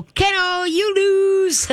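high, cheerful sing-song "kennel"; happy and upbeat. Shown as a demonstration. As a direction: sound delighted, like it's a fun game. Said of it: "just be happy about it".

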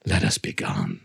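cold mocking echo. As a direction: echo him back deep and cold. Montresor coldly echoes plea.

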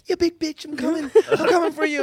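put-on high-pitched girl swearing in a game. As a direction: imitate a sweet girl talking trash, voice well up.

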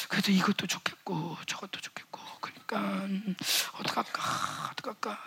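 whispering, wavering to himself; hesitant whisper. Retold or imitated. Preacher voicing a hesitant believer, whispered dithering. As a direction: mutter the doubts under your breath.

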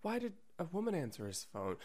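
hushed, high, worried movie wife; breathy soap-opera worry, half whispered; Lifetime movie wife. Retold or imitated. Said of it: "with two hands cupping a cup of tea and looking out the window and thinking".

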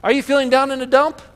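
put-on high, loud kid's campaign opener; son's campaign speech. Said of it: "This was his speech".

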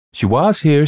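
put-on high, loud boy piping up; boy's voice. A narrator's reading. Boy answers worried mother, piping up. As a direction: pipe up like a young boy. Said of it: "said a boy".

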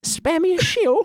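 very high mock pleading; begging orphan. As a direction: plead in a put-on waif's voice.

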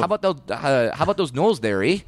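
put-on lightly done Canadian accent; a hint of Canadian, eh. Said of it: "half Scottish, half Canadian".

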